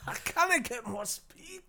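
a high, slow, lightly Irish voice. Retold or imitated. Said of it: "It's like [name] is in the room".

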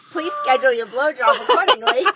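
usual speaking voice

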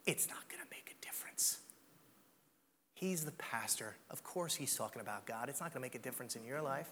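hushed, breathy whisper of doubt; whispered lie. Acted. Voicing the enemy's lie.

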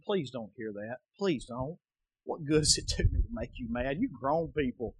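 quiet, low pleading; soft pleading. Real, not acted. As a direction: plead softly with them. Pleading softly with congregation.

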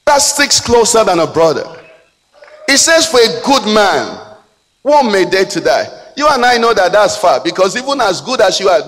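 high, loud pulpit preaching; impassioned preacher in full cry; full-force preaching. Acted.

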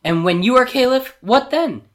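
high, loud, expectant servant; eager excitement. A narrator's reading. Servant asking Jafar what comes next, eagerly expectant. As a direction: push it up high, hungry for the answer.

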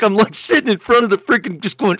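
usual speaking voice

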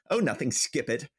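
ordinary talk, nothing marked

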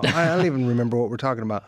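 rushed, caffeinated rattle; fast and wired on coffee; too much caffeine. Real, not acted. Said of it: "the coffee's really kicking in now".

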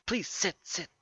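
high little voice for the talking stool; squeaky stool voice. Acted. Stool urging guests to sit, high and squeaky. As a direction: pipe up like a tiny eager stool.

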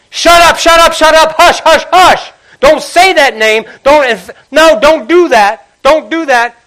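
very high, loud frantic hush; shushing shout. Retold or imitated. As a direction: clamp down on him hard. Crowd silencing the blind man.

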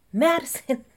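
high mock call of Madison!; hammed-up catchphrase yell; mock Danny call. Repeats the call, bigger.

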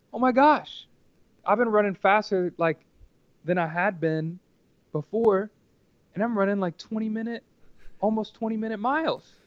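high, loud retold freak-out; alarmed realization. Runner panicking at the aid station, high and loud.

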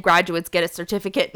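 ordinary talk

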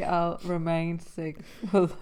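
laughing through the words; breaking into laughter. Real, not acted. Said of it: "laughing through the joke".

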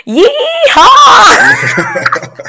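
very high, whooping shout; cowboy yell. Retold or imitated. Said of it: "at the top of my lungs".

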